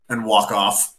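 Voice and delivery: usual speaking voice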